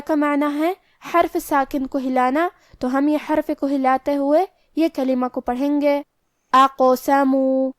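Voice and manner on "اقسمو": اقسمو with the ق bounced, drawn out slowly; qalqalah on qaf. Shown as a demonstration. Teacher showing students the bouncing qaf in اقسمو. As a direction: echo the ق in اقسمو, slow. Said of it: "لہذا ہمیں قاف کو قلقلہ سے پڑھنا ہے".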